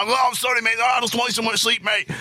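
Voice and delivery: high, put-on apologetic voice; mock homeless guy apologising to the car owner; homeless man voice. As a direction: play the just-woken stranger saying sorry. Voicing homeless man again.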